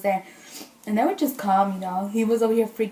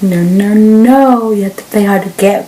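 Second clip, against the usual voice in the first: louder, drawn-out retold no; mock-firm refusal; 12 dB louder, slower than usual. Telling herself to play hard to get, mock firm. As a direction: lay down the rule to yourself, playfully. Acting out her firm self-talk.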